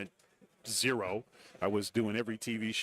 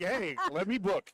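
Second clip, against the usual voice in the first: cheeky; 9 semitones higher, slower than usual. Retold or imitated. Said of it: "I'm just saying, like".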